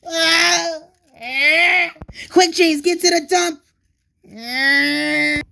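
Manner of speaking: loud, high frantic cries; terrified engine yelling a warning while chased; panicked screaming. Acted. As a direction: scream for help while fleeing. Being chased by Diesel.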